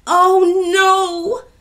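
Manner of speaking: very high, loud cry; alarmed shout. A narrator's reading. Ya spotting the angry troll, alarmed shout. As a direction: cry out in dismay. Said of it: "shouted Ya".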